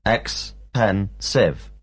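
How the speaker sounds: ex-pen-sive. drawn out in three slow beats; slow syllable split. Shown as a demonstration. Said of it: "the adjective expensive has three syllables".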